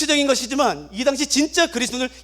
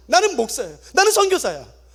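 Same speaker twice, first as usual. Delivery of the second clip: usual speaking voice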